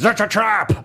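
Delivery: high, strained alien cry; hammed-up sci-fi commander's warning; Admiral Ackbar. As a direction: croak out an alarmed movie catchphrase. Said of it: "Admiral Ackbar from the Star Wars movies".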